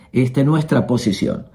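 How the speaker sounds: ordinary delivery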